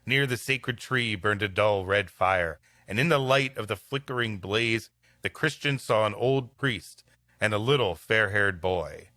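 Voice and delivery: normal talking voice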